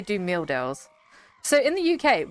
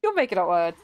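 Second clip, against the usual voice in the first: usual speaking voice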